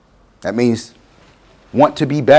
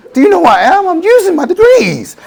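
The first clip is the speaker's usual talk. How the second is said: indignant retort. Retold or imitated. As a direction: snap back like your pride is hurt. He snaps back in anger.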